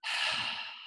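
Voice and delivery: long breathy open-mouthed exhale; voiceless "haah" from the back of the throat; audible breath demo. Shown as a demonstration. Teacher showing the class a throat breath, audible breath demo. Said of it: "So everyone say".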